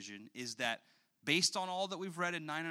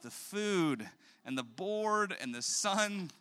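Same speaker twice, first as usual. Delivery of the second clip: normal talking voice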